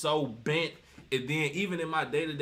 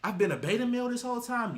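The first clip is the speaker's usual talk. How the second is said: imitating a stunned realization, halting then rushing higher; mock disbelief; 4 semitones higher than usual. Beta males realizing what they are, in mock disbelief. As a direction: play it dawning on him, a rising, incredulous question. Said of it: "The beta males be like".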